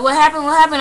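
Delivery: loud, high, repeated query; urgent questioning. Retold or imitated. Bystanders asking crying robbery victim, urgent questioning.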